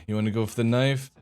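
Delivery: ordinary delivery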